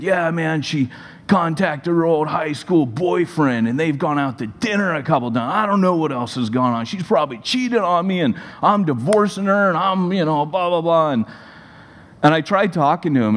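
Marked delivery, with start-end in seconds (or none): 0.0-11.1 higher-pitched agitated ranting, mildly acted; angry husband. Husband ranting to pastor about his wife, mock angry. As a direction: imitate an irate man working himself up, without real yelling. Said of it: "he was just yelling and getting more angry".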